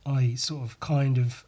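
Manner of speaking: ordinary talk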